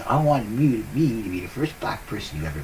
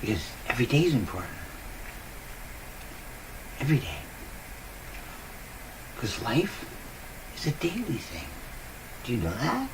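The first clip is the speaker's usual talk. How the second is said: usual speaking voice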